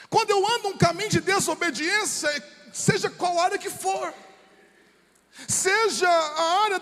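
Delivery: high, insistent pulpit delivery; forceful preaching. Real, not acted. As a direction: drive each phrase out to the congregation.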